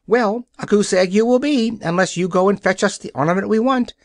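high, gleeful threat rushing into fast words; joyful gloating. Acted. The hen Billina threatens the Nome King, high gleeful gloating. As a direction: crow it out with glee, hurrying the condition. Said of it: "declared Billina with a joyful chuckle".

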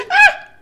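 loud high gasping shriek; a sudden laughing scream of fright; startled scream. Real, not acted. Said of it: "Sorry if that was loud".

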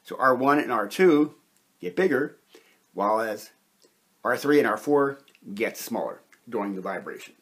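ordinary talk, nothing marked

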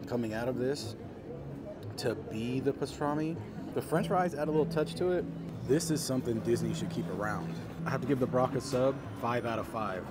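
ordinary talk

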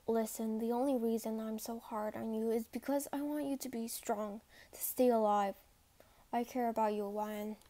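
low, softened, earnest voice; sincere. Acted. Corporal explaining he cares about the cadet, low and sincere. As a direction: confide gently in a deep register.

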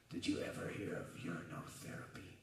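low, breathless whisper down the phone; whispered low confession. Retold or imitated. Wild Man on the phone to Danny, low and whispered. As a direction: hush it like a secret.

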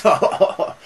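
high staccato mock laugh; imitated group laughter. Everyone laughing at Salieri's sarcasm, imitated high laughter. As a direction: act out a roomful laughing. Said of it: "and everyone laughs".

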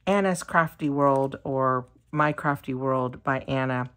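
ordinary talk, nothing marked

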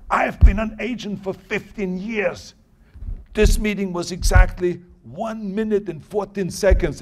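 mad agent. Retold or imitated. Agent scolding his actor after a very short meeting, mad. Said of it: "he was mad at me also".

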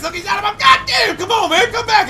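high shouting at the enemy; frustrated shout. Real, not acted. Chasing an enemy player, shouting high and frustrated. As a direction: yell after him in frustration.

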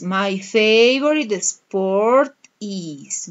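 very slow, drawn-out modelling of the answer; slow model answer. Shown as a demonstration. Teacher modelling the answer for pupils, very slow. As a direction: stretch each word so students can follow. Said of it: "the answer is".

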